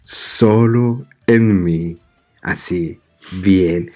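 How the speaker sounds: low, slow seductive whisper-voice; incubus. Pretend. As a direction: purr it like a seducer. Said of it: "hablo con una voz sensual". (voz sensual grave y lenta)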